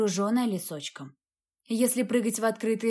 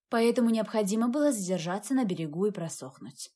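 ordinary delivery

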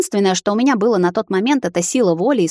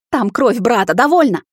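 higher, exasperated admission building louder; tense admission; 5 semitones higher, 4 dB louder than usual. A narrator's reading. Vampire admits it is his brother's blood, higher exasperated outburst. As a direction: give in angrily, voice climbing. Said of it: "зашипел он".

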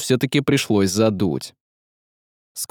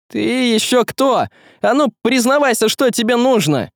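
high, raised-pitch demand to an intruder; defiant challenge; 11 semitones higher than usual. Acted. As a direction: challenge the creature, pitched well up. Василий challenges the menacing shadow.

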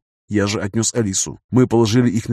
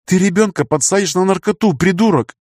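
high-pitched outraged scolding; heated female-character reproach; indignant; 8 semitones higher than usual. A narrator's reading. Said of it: "возмутилась Света".

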